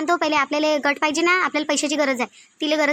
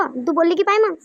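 normal talking voice

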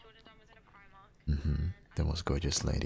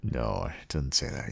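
ordinary talk, nothing marked

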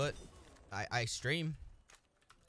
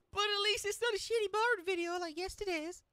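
silly high voice. Pretend. High put-on voice.

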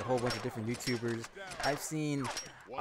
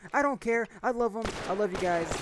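excited; 7 semitones higher, 4 dB louder than usual. Real, not acted. Enthusiastic address to viewers.

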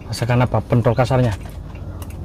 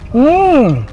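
high rising mmm of taste; delighted hum. Real, not acted. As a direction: savor the bite aloud. Tasting balungan, delighted.

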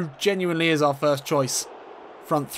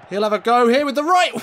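higher, louder rising shot call; excited call; 8 semitones higher, 7 dB louder than usual. Real, not acted. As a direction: call the strike as it happens. Shot coming.

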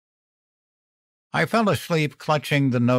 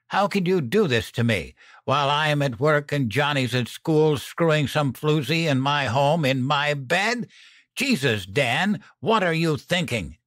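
higher-pitched, indignant accusation; hurt outrage; 6 semitones higher than usual. A narrator's reading. Wife confronts partner about cheating, higher indignant outrage. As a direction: build the questions in wounded disbelief.

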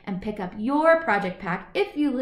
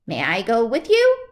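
louder bleating goat; 8 dB louder than usual. Acted. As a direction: bleat it eagerly. Goat asks to join.

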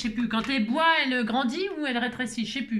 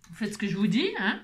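usual speaking voice

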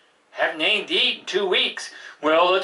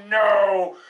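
ordinary talk

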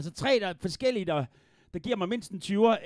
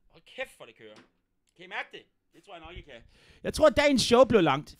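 normal talking voice

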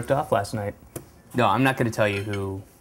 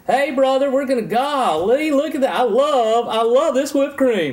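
high, loud Southern effeminate voice; Paula Deen's sons; 14 semitones higher, 5 dB louder than usual. Retold or imitated.

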